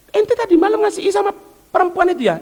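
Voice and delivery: high, pointed questioning voice; questioning friend. Retold or imitated. Friend quizzes the giver about last night, high and pointed.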